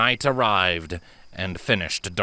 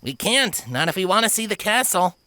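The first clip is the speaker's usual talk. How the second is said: rebuffing; 11 semitones higher than usual. Acted. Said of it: "the Pegasus of the group rebuffed".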